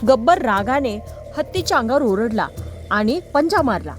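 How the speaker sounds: plain reading voice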